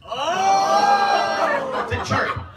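high loud yelp breaking into laughter; laughing shout. Real, not acted. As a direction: burst out laughing mid-word. Cracking up mid-line.

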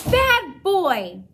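loud, high scolding; scolding shout. A narrator's reading. Girl scolds her dog loudly, a firm shout. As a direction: make the dog freeze.

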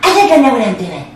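high, put-on huffy sister voice; indignant sister. Sister defending her cooking on the phone, indignant.

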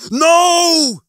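high, loud, long-held cry; screamed. A narrator's reading. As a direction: scream it out to stop him. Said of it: "screamed Lister".

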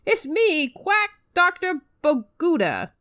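lightly voiced quack doctor, lisping; a hint of a lisping doctor greeting the hero; character with a lisp. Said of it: "He's got a, a weird lisp now".

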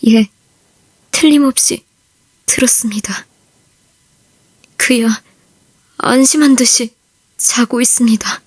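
tearful whisper. Acted. Soldier consoles over dead comrade.

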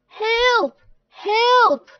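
high, loud cry of alarm; drawn-out, high-pitched yell; shouting for help. Acted. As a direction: call out as if danger is close. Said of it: "he shouted".

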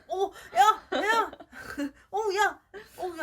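laughing shouts at a jumping dog; laughing yell. Retold or imitated. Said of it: "얘가 반가워서 막 이렇게 하는데".

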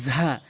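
"ঝা" as a short buzzing z; tongue-tip z in "ঝা", cut short; contrast drill. Shown as a demonstration. Teacher contrasting two letters, buzzed short "ঝা". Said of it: "উচ্চারণ করার পরে বুঝতে পারছেন".

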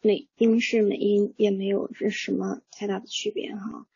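a soft unvoiced aside; whispered aside. Real, not acted. Teacher whispers an aside to the class. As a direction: drop to a whisper for the side remark.

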